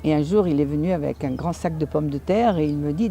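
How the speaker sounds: usual speaking voice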